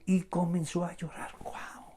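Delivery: softly fading, as if struck by it; hushed amazement; moved hush. Real, not acted. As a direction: drop away almost to a whisper. Moved by Jesus weeping.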